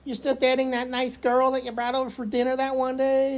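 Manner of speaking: high-pitched mock motherly prying; doting mom. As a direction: play a mom asking after the girlfriend. Acts out the phone call's mother.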